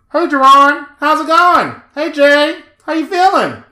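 high, loud cheerful robot greeting; chirpy device voice. Pretend. Mocking a smart mask that talks for you, chirpy loud greeting. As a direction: sound like a gadget greeting its owner. Voicing the mask's phone speech.

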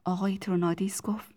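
ordinary delivery, nothing marked

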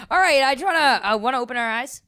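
loud. Real, not acted. Ending prayer loudly.

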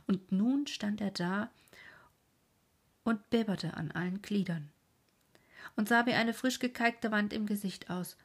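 ordinary delivery, nothing marked

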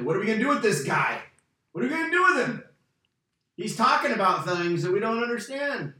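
worried elders at a loss; puzzled church leaders. Retold or imitated. Jerusalem leaders fretting over what to do with Paul, anxious.

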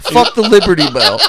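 hammed-up angry comic; mock rant. Retelling Burr's rant, laughing and loud. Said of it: "He's like".

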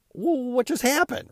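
high, bright astonished exclaim; high-pitched wow, can't believe it; amazed disbelief. Real, not acted. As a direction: sound floored by the numbers. Stunned by two hundred downloads.

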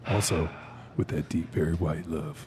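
low, soft crooning ladies' man; deep sexy voice. Pretend.

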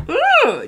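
a sudden high, loud ooh of hurt; pained ooh. Real, not acted. Coach recalling a boss's critique, pained ooh. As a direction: let out a stung ooh. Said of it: "that hurts when somebody says that to you".

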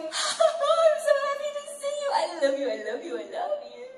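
God overjoyed to see you, high and beaming; excited happy greeting. Pretend. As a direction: greet them like a father overjoyed to see his child. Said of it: "He's there going".

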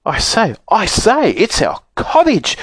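high, surprised little-bear squeal; delighted shriek. A narrator's reading. Baby bear spots the cottage, shrieking in delighted surprise. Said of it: "shrieked the baby bear in surprise and delight".

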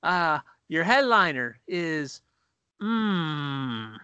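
mock host stalling mid-introduction; unprepared stage host. Comic imitating a host who forgot the headliner, stalling. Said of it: "he's on stage, and he goes".

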